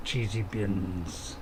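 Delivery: deep, very slow and drawn-out cheesy bins; mock-grand low drawl, stretching each word; silly put-on voice. Gamer boasting to stream viewers, deep slow silly voice.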